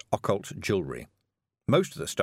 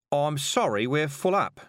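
higher-pitched, put-on apology; lying driver; 6 semitones higher, 3 dB louder than usual. A narrator's reading. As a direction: turn the stranger away with a false apology. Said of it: "the driver lied".

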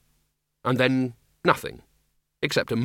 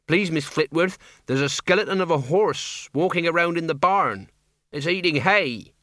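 raised-pitch country character voice; anxious farmhand; 5 semitones higher than usual. Acted. Farmhand tells Miss Flitworth of strange horse, raised voice. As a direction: report it urgently, pitched up.

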